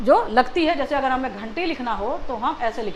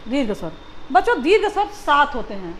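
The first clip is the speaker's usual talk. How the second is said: usual speaking voice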